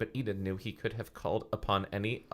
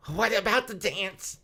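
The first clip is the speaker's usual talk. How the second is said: put-on high, slow girlish voice; Enid worried; 13 semitones higher, slower than usual. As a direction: ask it as an anxious teen girl.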